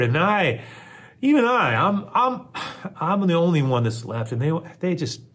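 high, loud, worked-up prophet's complaint; distraught Elijah. Acted. Elijah complaining to the Lord he's alone, distraught.

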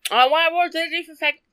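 talking around ice in the mouth; words blurred by a mouthful of ice; mouth full. Real, not acted. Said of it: "sorry, I got ice in my mouth".

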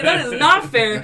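"not fair" stretched out, high and loud; slow down and lean loudly on "not fair"; emphatic protest. Retold or imitated. Caller protesting repossession, "not fair" slow and emphatic.

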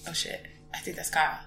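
low, quick, under the breath; hushed realisation. Retold or imitated. Fan spotting a band member after the show, hushed. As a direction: quietly tell yourself, trying not to be noticed. Spotting the musician, muttering to self.

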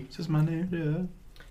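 soft, high put-on voice; movie catchphrase impression. Co-host echoing a movie catchphrase, soft high imitation. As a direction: mimic the film line quietly, high-pitched. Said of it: "I thought he goes".

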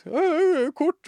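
very high, loud mock panic; panicked shouted reply. Scared passenger answering the driver about payment, yelled. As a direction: yelp the answer as a flustered passenger.

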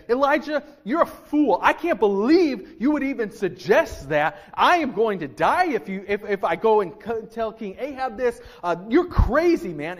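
loud, high, exasperated outburst; angry rant. Pretend. Obadiah snapping at Elijah, loud and indignant. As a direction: fling the accusation at him. Pastor acts out hypothetical angry retort.

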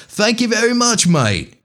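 a light, put-on Australian accent. Podcaster thanking overseas listeners, in a light mock Australian accent. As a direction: thank them the way an Aussie would, casually. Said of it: "I'm gonna quit the Australian accent".

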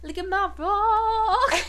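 high, slow drawn-out proud brag; proud show and tell; mock proud. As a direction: show it off at work. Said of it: "I be like".

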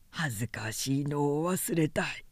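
whispered, hanging your head; ashamed whisper. A narrator's reading. Drunkard confessing his shame, ashamed whisper. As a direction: confess it under your breath. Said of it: "うつむきながら打ち明けた".

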